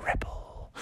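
soft voiceless whisper; whispered effect. Acted. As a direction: whisper it like a tiny echo. Water rippling between footsteps.

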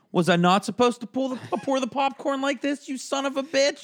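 practicing a sarcastic outburst for the boss; sarcastic rehearsed rant. Pretend. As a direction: rehearse the big quitting speech with sneering sarcasm. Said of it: "almost doing the practicing in the mirror".

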